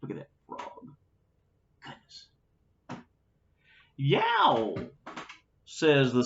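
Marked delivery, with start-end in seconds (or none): 0.0-1.0 whispered aside. Real, not acted. Reader's aside to listener. 1.8-2.3 whispered goodness. Real, not acted. Reader's aside to listener. 4.0-4.9 a sudden high yelp; small pig yelping as a turtle bites his tail; pained yelp. Acted.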